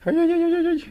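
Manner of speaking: high-pitched string of dismayed ay; distressed wailing. Acted. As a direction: wail in comic alarm, voice jumping way up. Captain dismayed by summons news.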